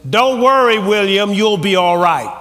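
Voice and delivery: loud, high booming divine voice; voice of God. Retold or imitated. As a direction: proclaim it as God speaking. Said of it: "And God spoke to me. He said".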